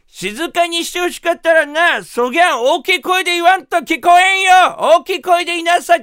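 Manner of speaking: high, loud Kumamoto granny; old farming granny. Retold or imitated. Granny asks receptionist where rakugo is, loud old-woman voice. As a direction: badger loudly like a hard-of-hearing old woman. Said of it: "そのおばあちゃんが".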